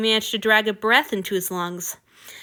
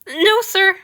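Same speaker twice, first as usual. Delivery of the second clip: high, loud nervous squeak; 11 semitones higher, 7 dB louder than usual. A narrator's reading. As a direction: squeak it out in alarm. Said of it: "Hanyu squeaked".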